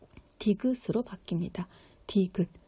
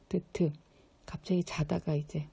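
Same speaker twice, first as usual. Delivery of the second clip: usual speaking voice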